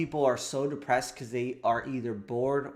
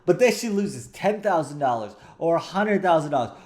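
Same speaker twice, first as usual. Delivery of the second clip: usual speaking voice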